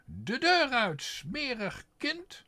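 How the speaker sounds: high, loud order to get out; furious mistress. A narrator's reading. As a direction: bark it high and loud, smerig kind drawn out slowly.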